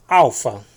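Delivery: Alfa said loudly and clearly as /awfɐ/, falling; Greek letter name demo. Shown as a demonstration. Teacher presenting the letter Alfa to students, loud and clear. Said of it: "vamos fazer uma pronúncia aqui".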